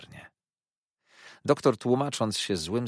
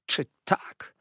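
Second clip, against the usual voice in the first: whispered sneer; 4 dB louder than usual. A narrator's reading. Uriah answers the blow.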